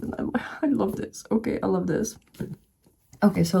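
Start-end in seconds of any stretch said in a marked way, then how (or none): 0.0-2.2 high, whispered squeal of delight; breathless gush. Real, not acted. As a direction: gush under your breath over something adorable. Gushing over cute book page.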